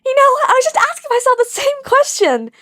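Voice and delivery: high, strained yell while running; yelling back. Acted. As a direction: yell it back over your shoulder. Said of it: "Izuku yelled back".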